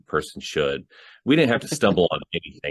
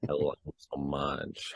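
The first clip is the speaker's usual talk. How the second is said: imitated phone flirting; 2 semitones lower, 10 dB quieter than usual. Horror victim answering the caller about movies, imitated softly. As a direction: drop to a hushed, coy murmur. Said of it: "he's asking her about horror movies".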